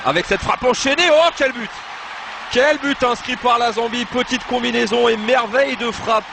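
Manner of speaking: high excited goal yell; long goal yell. Real, not acted. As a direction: belt out the goal call. Second goal scored.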